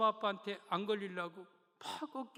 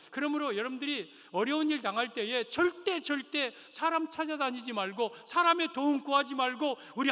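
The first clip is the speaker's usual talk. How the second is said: louder, rising fervent exhortation; preaching at full force; 8 semitones higher, 9 dB louder than usual. Real, not acted. Pastor urges the congregation to trust God, fiery preaching. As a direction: build up to a fiery plea.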